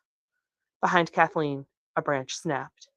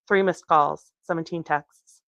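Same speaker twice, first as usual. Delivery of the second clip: ordinary delivery, nothing marked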